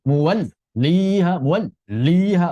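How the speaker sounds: 'muwalliha' split as muwal, then liha; wrong broken lam. Shown as a demonstration. Teacher repeats earlier mistake, broken lam. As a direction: break 'muwalliha' after the l. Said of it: "bukan begitu".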